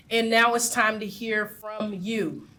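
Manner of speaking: normal talking voice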